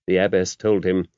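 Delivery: ordinary delivery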